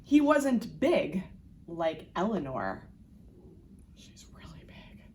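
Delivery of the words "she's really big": put-on hushed whisper aside to the kids; stage whisper. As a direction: whisper it like a secret. Aside about big monster.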